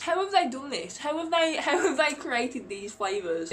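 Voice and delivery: high, amazed disbelief. Real, not acted. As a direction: marvel out loud, half laughing. Amazed by the taste.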